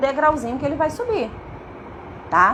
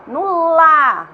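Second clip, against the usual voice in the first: "lá" held long, louder and higher; stretched syllable; 4 semitones higher, 8 dB louder than usual. Shown as a demonstration. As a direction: draw out the vowel in "lá" loudly. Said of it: "qual é a vogal que eu ouço na sílaba lá?".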